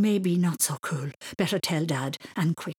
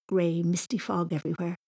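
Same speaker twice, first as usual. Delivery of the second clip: ordinary delivery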